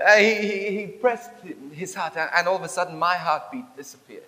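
ordinary talk, nothing marked

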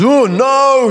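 a dad seeing the glass break; dad's alarm. Retold or imitated. Child breaks glass, dad reacts.

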